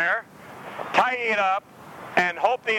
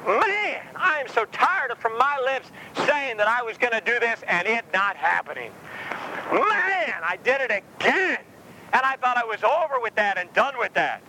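high, exasperated self-reproach; frustrated believer; 8 semitones higher than usual. Pretend. Preacher voicing a believer fed up with himself. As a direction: groan at your own failings. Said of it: "You go".

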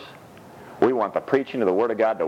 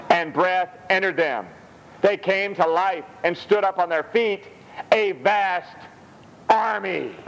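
scripture reading; 7 semitones higher than usual. Acted. Reads scripture aloud.

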